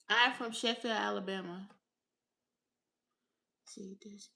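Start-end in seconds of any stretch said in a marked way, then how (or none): 3.6-4.3 soft whisper to herself; a real whisper under her breath; whispered aside. Real, not acted.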